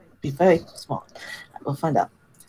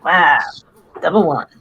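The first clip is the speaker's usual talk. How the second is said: loud, shocked outburst at the dice; real disbelief at a terrible roll; stunned wow; 2 semitones higher, 13 dB louder, slower than usual. Real, not acted. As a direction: blurt out the result in shock. Low roll shocks the player.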